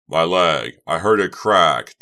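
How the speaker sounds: loud panicked pain cry; shouting while wounded. Acted. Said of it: "Doug cried, his face twisted in pain and panic".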